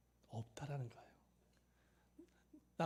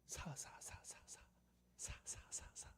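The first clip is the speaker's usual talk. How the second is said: stuck on the first syllable, whispering; acted breathy, halting stutter; whispered stammer; 12 dB quieter, slower than usual. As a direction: try and fail to get the word out. Pastor cannot get the word out.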